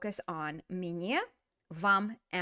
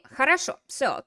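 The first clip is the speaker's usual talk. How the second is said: normal talking voice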